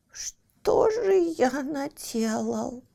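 slow whispered whine; plaintive whimper. Acted. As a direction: whimper it pitifully. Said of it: "жалобно заскулил Лаки". (жалобный скулёж)

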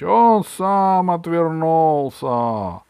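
loud, high, drawn-out retort; shouting back. A narrator's reading. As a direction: bellow the excuse slowly across the field. Robbers shout back in the dispute. (громкий протяжный выкрик)

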